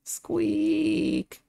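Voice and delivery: high squeaky mouse squeak; tiny squeak shown off to chat. Shown as a demonstration. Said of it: "Listen to this".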